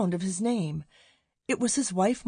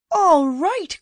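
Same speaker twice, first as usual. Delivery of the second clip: much higher, loud, rising cry; joyful cry; 10 semitones higher, 10 dB louder than usual. A narrator's reading. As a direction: burst out with delight. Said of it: "cried Emmett".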